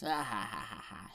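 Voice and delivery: a hammed-up gloating cackle; mock villain laugh. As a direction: laugh him off like a smug gangster. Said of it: "Jabba the Hutt just laughs and goes".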